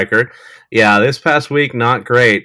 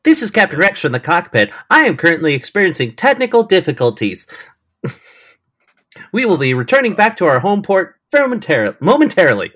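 captain announcement; 8 semitones higher than usual. Pretend. Pilot announcement parody.

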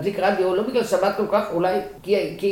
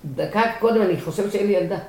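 ordinary talk

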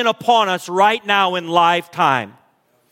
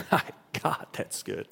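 breathless hushed aside; awed whisper; 12 dB quieter than usual. Real, not acted. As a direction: whisper it to yourself, overwhelmed. Awed aside after the verse.

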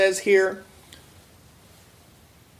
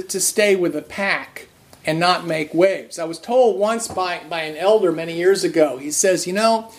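usual speaking voice

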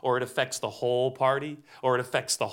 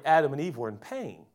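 usual speaking voice

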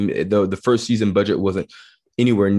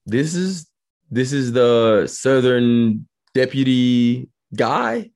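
slow, drawn-out doubtful question, higher pitched; retold disbelief; 3 semitones higher, much slower than usual. Actor recalls doubting a British co-star at table read, disbelief. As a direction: stretch it out, rising incredulously on the last word. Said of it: "and I'm like".

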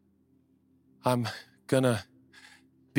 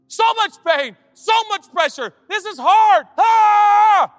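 rising from loud complaint to a high, loud anguished yell; building mock outcry; 10 dB louder, slower than usual. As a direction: crank the despair up to a hammed-up scream. Acting out pressure, building outcry.

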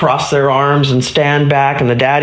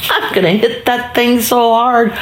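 put-on higher-pitched small boy's brag; boastful little boy; 6 semitones higher than usual. Older boys waiting their turn, boastful high kid voice. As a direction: put on an eager little kid's voice. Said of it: "four and five-year-old boys, said".

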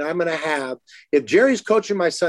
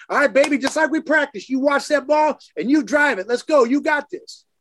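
imitating mom's higher-pitched pep talk; cheering mom; 8 semitones higher than usual. Father imitating mom urging their daughter to swing, higher mock pep talk. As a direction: hype her up like mom at the fence.

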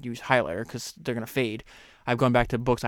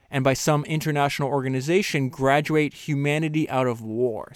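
raised, measured reading voice; reading a quote aloud; 3 semitones higher, 5 dB louder than usual. Acted. Host reading a book passage to listeners, measured. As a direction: read the author's words with weight.

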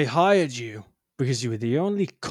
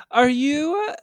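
society lady; 6 semitones higher than usual. Acted.

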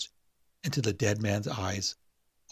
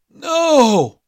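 high, very loud cry of horror; a buried man's panicked no; terrified scream; 12 dB louder than usual. A narrator's reading. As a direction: scream as the lid slams shut. Harris trapped in coffin.

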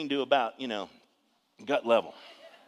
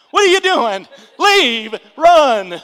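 high, full-volume acted scream; 19 dB louder, much slower than usual. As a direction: scream a frantic warning at the movie screen. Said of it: "you're screaming at the T V going".

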